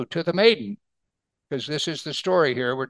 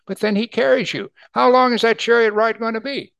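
higher and louder, building with wonder; excited preaching; 7 semitones higher, 6 dB louder than usual. Real, not acted. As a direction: lift it up with rising excitement.

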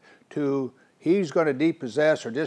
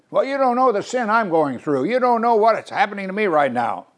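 higher, louder voice of a troubled believer; pleading objector; 8 semitones higher, 5 dB louder than usual. Pretend. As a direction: plead like someone buried in sin. Imagined objector pleading his case.

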